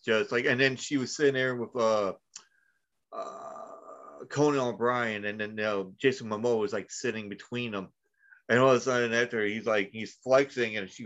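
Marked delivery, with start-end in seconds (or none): none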